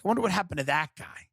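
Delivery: wondering aloud. Retold or imitated. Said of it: "I'll be like".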